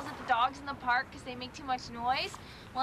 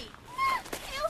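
very high squealed ew; panicked disgust. Acted. Kat touched by wet Kleenex, high panicked ew. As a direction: squeal in revulsion.

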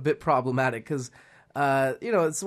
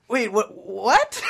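puzzled teacher; 8 semitones higher, 4 dB louder, slower than usual. Retold or imitated. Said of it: "she's like".

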